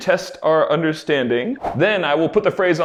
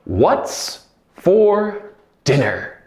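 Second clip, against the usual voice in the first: slow clear repeat; 5 semitones higher, much slower than usual. Shown as a demonstration. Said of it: "That phrase was".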